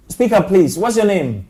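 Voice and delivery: loud, high call down a bad phone line; to someone hard of hearing. Real, not acted. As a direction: raise your voice so the caller hears. Caller barely audible on phone line.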